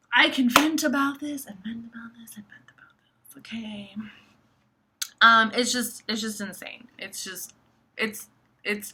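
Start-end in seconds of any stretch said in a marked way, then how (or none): 0.1-3.9 fading into whisper. Real, not acted. Venting to viewers about this, fading into a whisper. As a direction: start out voiced and emphatic, then dissolve into whisper.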